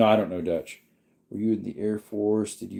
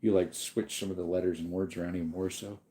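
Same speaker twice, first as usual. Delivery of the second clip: ordinary talk, nothing marked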